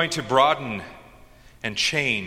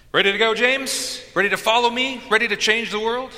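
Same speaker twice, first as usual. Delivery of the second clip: high-pitched rallying questions; rousing call; 11 semitones higher, 4 dB louder than usual. Acted. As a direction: call out to recruit them, rising with urgency. Jesus rallying disciples, acted out.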